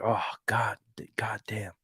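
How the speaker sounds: a hushed, breathless oath nobody should hear; whispered curse. Retold or imitated. Lance Corporal at the window whispering a curse to himself. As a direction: whisper the curse under your breath, unvoiced.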